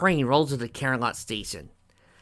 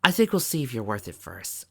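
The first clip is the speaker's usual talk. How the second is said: plain reading voice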